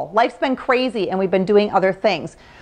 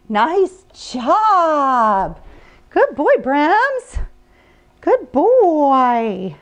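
dog praise; much slower than usual. Real, not acted.

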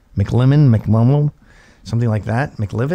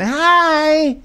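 put-on high bright drunk greeting; mocking a drunk woman bouncing back; perky drunk hi. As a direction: pop back up cheerfully. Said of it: "she'd be up again".

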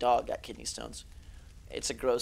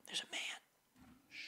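whispered there's a man; real tense whisper; 18 dB quieter than usual. Real, not acted. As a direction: hush it so he won't hear. Spots enemy, hushes.

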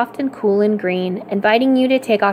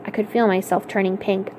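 plain reading voice

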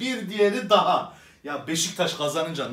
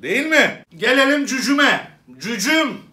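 mock call out; 6 semitones higher, 5 dB louder than usual. Comic switching to address referee.

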